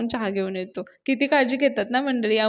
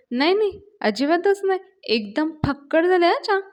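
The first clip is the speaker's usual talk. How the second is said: feigned enthusiasm; 7 semitones higher than usual. Retold or imitated. Anna hides bitter tea, overpraising.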